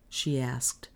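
usual speaking voice

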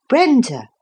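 firmly. A narrator's reading. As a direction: stop her short with the name. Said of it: "said Lucy firmly".

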